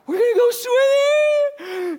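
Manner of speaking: high, loud, slow squeal of delight; little girl shrieking with joy about swimming; thrilled little girl. Retold or imitated. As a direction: squeal it like an overjoyed toddler. Daughter voiced, thrilled.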